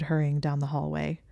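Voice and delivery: plain reading voice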